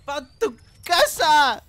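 high, slow triumphant yell, word by word; excited shouting. Real, not acted. Player sends beaten enemy home, excited shouting. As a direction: bellow it out in gleeful victory. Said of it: "Perdón por gritarles en el oído".

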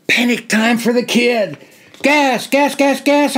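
high, loud terrified scream; screamed in terror. Acted. Soldier screaming a fake gas alarm at the officers. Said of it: "I screamed in total terror".

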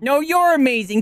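high, excited return compliment; gushing thanks. Real, not acted. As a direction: gush it right back warmly. Answers alert in high voice.

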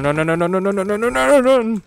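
rising frantic pleading, then a scream; panicked no's building to scream. Real, not acted. Monster chasing the player.

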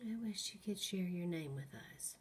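ordinary talk

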